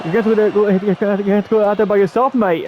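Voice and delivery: Finn Balor, attempted Irish accent. Wrestler sending his teammate out alone, badly faked Irish accent. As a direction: have a shaky go at an Irish accent. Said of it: "trying to do his Irish accent".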